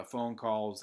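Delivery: ordinary talk, nothing marked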